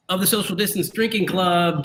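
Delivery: ordinary talk, nothing marked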